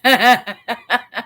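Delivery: chuckling run of short bursts; real laugh. Real, not acted. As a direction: laugh freely in a trailing chuckle. Amused by viewer's comment.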